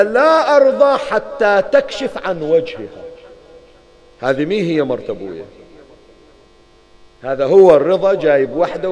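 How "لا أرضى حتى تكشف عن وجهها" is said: loud, higher, slow stubborn demand; stubborn refusal. Acted. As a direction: drag out each word of the demand, لا stressed. Abbas stubbornly refuses the judge. (رفض عنيد بصوت عالٍ وبطيء)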